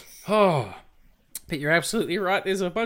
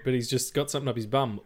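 ordinary talk

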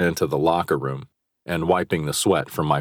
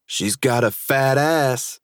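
slow smug taunt; 8 semitones higher, slower than usual. A narrator's reading. Rival taunting.

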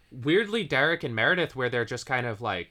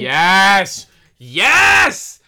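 loud, high, thrilled yell; excited shout; 5 semitones higher, 14 dB louder than usual. Real, not acted. Cohost shouting yes in excited agreement. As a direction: burst out with total agreement.

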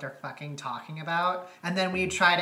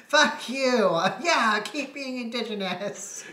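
higher, louder jeer through laughter; playfully heckle, pitched up and loud; mock jeering; 5 semitones higher, 4 dB louder than usual. Acting out the cartoon exchange about Randy, mock jeering. Acts out mocking exchange from episode.

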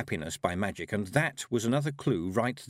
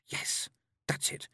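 whispered, slow dawning; hushed realisation; 6 dB quieter, slower than usual. A narrator's reading. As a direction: whisper it as the answer lands. Tiffany takes in the answer.

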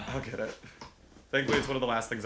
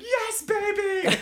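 high, loud excited cheer; 6 dB louder, much slower than usual. Retold or imitated. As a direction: cheer like a proud boyfriend. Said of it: "He's like".

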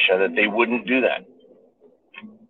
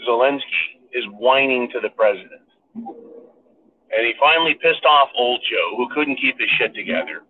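ordinary talk

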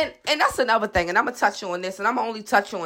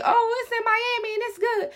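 mocking voice; 13 semitones higher than usual. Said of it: "anybody that say".

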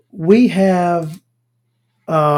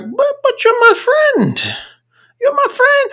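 very high, plaintive mock-Irish squeak; Colin Farrell, bad Irish accent. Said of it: "I can't do an Irish accent".

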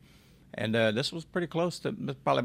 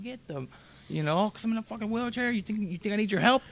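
retold outburst; 10 semitones higher than usual. Blew up on this lady.

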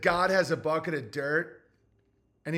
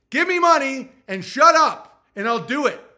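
higher, louder curt demand; blunt mock demand; 6 semitones higher, 8 dB louder than usual. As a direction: snap it like an impatient boss. Imitating his own blunt demand.

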